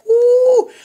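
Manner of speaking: loud high whoop; a gleeful shout after the rant. Real, not acted. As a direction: let out a big excited whoop. After acting out blocking ex.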